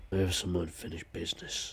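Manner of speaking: low, hushed menacing sign-off; ominous villain line. Acted.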